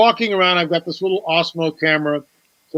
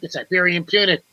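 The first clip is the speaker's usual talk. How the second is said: ordinary talk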